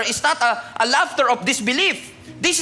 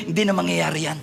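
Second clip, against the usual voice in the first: doubting laugh; 5 semitones lower, 3 dB quieter, faster than usual. Retold or imitated. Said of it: "natatawa ka na lang".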